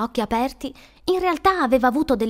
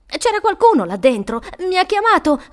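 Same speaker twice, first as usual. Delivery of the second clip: loud, climbing higher; agitated insistence; 9 semitones higher, 6 dB louder than usual. Acted. Shaken boy insisting to woman, agitated. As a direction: insist in alarm that it happened.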